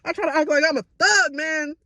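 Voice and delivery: high tearful voice, ending in a laugh; fighting tears. Real, not acted. Telling self and viewers not to cry, tearful. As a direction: talk yourself out of crying. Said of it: "I didn't wanna cry".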